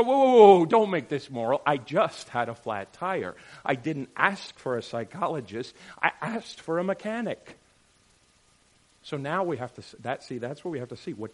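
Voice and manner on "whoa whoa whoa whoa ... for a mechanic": indignant protest. Retold or imitated. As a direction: throw up your hands at the moral question. Indignant driver retort.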